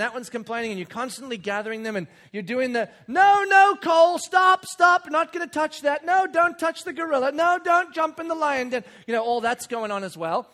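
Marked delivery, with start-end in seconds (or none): 3.1-8.8 loud, high string of urgent warnings; frantic parental shouting. Retold or imitated. Dad corralling kids at Animal Kingdom, frantic. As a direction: call out to stop a runaway child.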